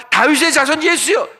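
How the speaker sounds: high, loud cry of faith; a woman's desperate plea to Jesus, cried out; fervent cry. Retold or imitated. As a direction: call out to Jesus with all your heart. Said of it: "이렇게 믿음의 고백을".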